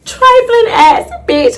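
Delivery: high, drawn-out scornful insult; acted outrage. Insulting the cousin who called her ex, outraged. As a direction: stretch the insult out, scandalized.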